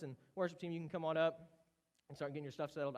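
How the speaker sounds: usual speaking voice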